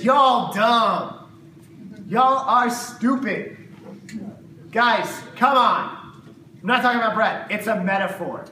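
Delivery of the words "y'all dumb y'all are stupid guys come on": louder, higher and slow, hammed-up scolding; a mock rant at hopeless students; exasperated outburst. Preacher imagines Jesus scolding his disciples, loud and slow. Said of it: "He could have hauled off and said".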